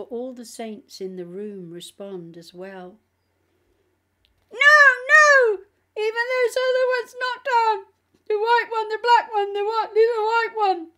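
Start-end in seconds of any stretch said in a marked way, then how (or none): 4.5-10.9 high, loud, panicked demon cry; shrieking demon. A narrator's reading. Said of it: "the demon said".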